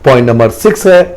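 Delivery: normal talking voice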